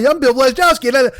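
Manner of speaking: high, fairly loud mock rant intro; shouting an announcement into the mic; retold yelling. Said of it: "Now, I'm still yelling".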